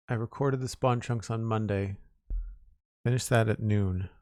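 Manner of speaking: ordinary delivery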